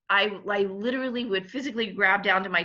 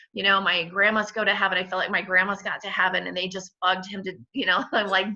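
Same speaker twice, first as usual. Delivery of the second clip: usual speaking voice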